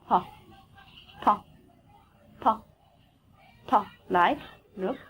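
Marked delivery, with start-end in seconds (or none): 0.1-3.8 "tha" sounded out alone; theta phoneme demo. Shown as a demonstration. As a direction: repeat "tha" separately for learners. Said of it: "behaves like this phoneme".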